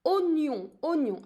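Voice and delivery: "oignon" with the oi as a plain o, no wa; teacher showing the exception in "oignon". Shown as a demonstration. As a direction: say "oignon" as if spelt with only an O. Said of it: "I'm not pronouncing it wa".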